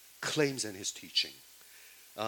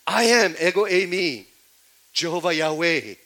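much higher and louder, ringing out "I am"; booming proclamation; 5 semitones higher, 12 dB louder than usual. Acted. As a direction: announce it like a divine title. Preacher voicing the I am sayings.

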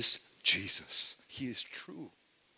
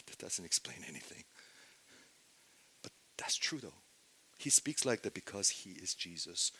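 normal talking voice

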